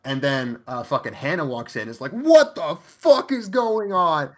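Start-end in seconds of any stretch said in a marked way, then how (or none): 2.1-4.3 high, loud, slow mock shout; barging in yelling at the sight; shocked yell. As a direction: yell it like walking in on chaos. Hannah walks in, shocked.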